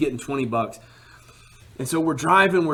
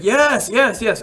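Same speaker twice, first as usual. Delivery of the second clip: eager yes; 8 semitones higher, 4 dB louder, slower than usual. Retold or imitated. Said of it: "he's like".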